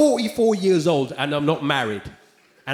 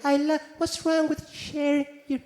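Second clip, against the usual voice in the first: [name] voice; 4 semitones higher, much slower than usual. Retold or imitated. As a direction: lightly mimic Michael's gentle voice. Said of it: "What's with that voice?".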